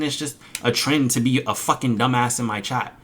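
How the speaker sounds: ordinary talk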